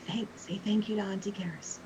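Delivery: softly murmured reminder of manners; whispered prompt. Acted. Parent whispering over to children to thank Auntie, hushed. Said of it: "I will whisper over to them and say".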